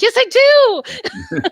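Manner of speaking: high, slow insistent whine; defiant. Retold or imitated. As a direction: drag it out, stubborn. Retold defiant reply to therapist.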